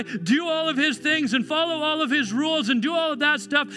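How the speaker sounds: high, emphatic pulpit voice; full-force preaching. Acted. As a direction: drive each point home with fervour.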